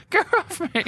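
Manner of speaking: very high playful gnome squeal. Pretend. Echoes the yelled gnome cry.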